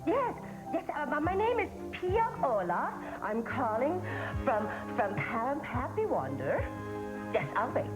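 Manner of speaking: lilting fake Swedish accent; put-on Swedish accent. Fake camp caller asking for the boy's father, put-on Swedish accent. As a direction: bluff through a call in a mock Swedish accent. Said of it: "You sound funny with that accent".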